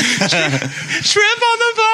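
high, drawn-out play-call yell; mock yell. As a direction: shriek it like a kid at kickoff. Acts the kids' yelled play call.

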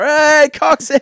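loud, high shout of a name; cheering call. Retold or imitated. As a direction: call out to the singer. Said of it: "everyone went up the bar and go".

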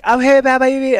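lightly voiced stranger on the phone; jumbled caller voice. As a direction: play the garbled voice on the line. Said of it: "just this jumbled voice".

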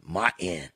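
low, soft Martin with the t dropped; nowadays pronunciation. Shown as a demonstration. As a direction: murmur Martin as "Mah-in", no t. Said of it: "That's how they say it nowadays".

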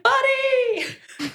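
high-pitched, drawn-out call in mock shouting. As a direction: imitate a camp guy calling out across the water. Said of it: "starts shouting".

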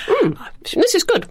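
appreciative high mmm of tasting; savoring delicious food. Real, not acted. Tasting a cricket snack.

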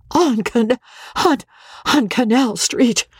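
breathless, broken-off high wheeze; wheezing Parthy. A narrator's reading. Frantic wife wheezing the news at her husband. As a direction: gasp the words out in a high, stuttering wheeze. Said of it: "she wheezed".

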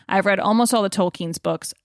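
usual speaking voice

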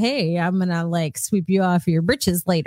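lightly voiced low smooth-talker; subtle seducer. Voicing a subtle masculine scent as a low-key flirt. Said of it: "it's masculine, but it's, it's subtle".

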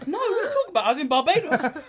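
very high, loud retort through laughter; mock protest. Acting the player answering the manager, laughing mock protest. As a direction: imitate someone squawking back in disbelief, cracking up.

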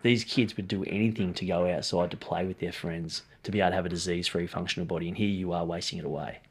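low, soft retold plea; grave, hushed telling-off; sombre reproach. Retells confronting client at cancer ward.

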